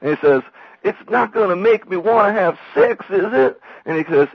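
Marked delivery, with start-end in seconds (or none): 0.8-3.6 high, loud naive southerner; Dewey Cox, very naive. Retold or imitated. Dewey worries about reefer's effects, very naive southern voice. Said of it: "like, very naive".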